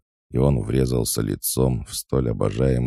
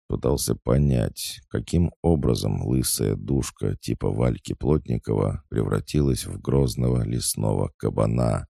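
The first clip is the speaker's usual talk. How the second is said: ordinary delivery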